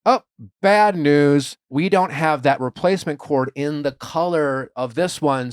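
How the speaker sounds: slow, drawn-out apology; slow bad news. Retold employee, slow.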